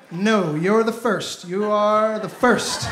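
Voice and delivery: slowly, overly earnest; slow reassurance. Retold or imitated. Boyfriend lying to girlfriend, slow earnest reassurance. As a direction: stretch each word to sound convincing. Acted quick lie to girlfriend.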